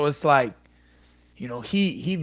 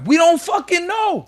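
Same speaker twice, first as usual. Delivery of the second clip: much higher and louder, exasperated; heated outburst; 13 semitones higher, 4 dB louder than usual. Real, not acted. Fighter ranting at a wrestler who avoided grappling, loud and high. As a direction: let the frustration burst out.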